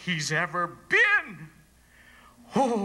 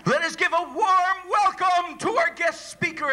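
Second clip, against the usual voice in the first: high, louder announcer voice; formal introduction; 4 dB louder than usual. Acted. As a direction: announce the guest speaker with fanfare.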